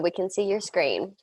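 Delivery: ordinary talk, nothing marked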